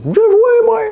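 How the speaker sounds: vieillard de quatre-vingt-dix ans. Acted. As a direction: caricature de vieillard très aiguë et forte. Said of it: "va avoir quatre-vingt-dix ans". (voix de vieux exagérée, haut perchée)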